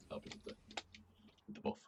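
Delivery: ordinary delivery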